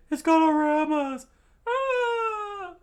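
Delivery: loud, very high falsetto scream; hammed-up high scream of alarm; panicked shriek. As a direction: squeal in cartoon terror. Acted panic as whale charges.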